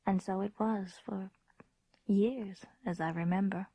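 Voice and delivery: reflective storyteller narration; story narration. A narrator's reading. Grown woman recalling her childhood castle, hushed narration. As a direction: tell it as a memory tinged with dread.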